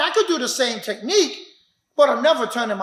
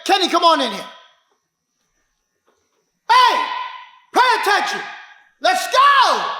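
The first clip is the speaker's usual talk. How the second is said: raised loud shout; loud call; 5 semitones higher, 4 dB louder than usual. Real, not acted. Instructor shouting to call the class to attention. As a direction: call them in sharply.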